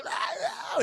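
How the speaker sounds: shrill startled scream; high scream. Retold or imitated. Old lady seeing the zombie wife, high scream. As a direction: scream in fright.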